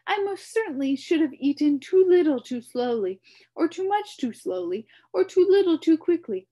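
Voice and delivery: high, loud, rambling bug voice; babbling humbug. A narrator's reading. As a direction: ramble on, overfed and flustered.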